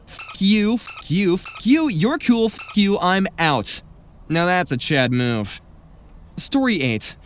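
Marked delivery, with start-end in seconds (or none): 0.1-3.8 mock defiant quitting rant, pitched up; hammed-up kiss-off, higher on each jab; acted-out swearing send-off. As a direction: act out a punchy farewell to each coworker. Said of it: "It went out like this".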